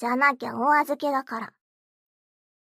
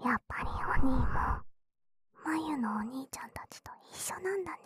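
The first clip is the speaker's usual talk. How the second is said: mocking whisper; faster than usual. Sister whispers a taunt at her new brother. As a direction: lean in and whisper the jab.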